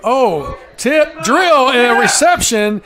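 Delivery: big rising shout of play-by-play delight; excited call. Real, not acted. Announcer calling a tipped catch to listeners, excited shout. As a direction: call the catch like the crowd's on its feet.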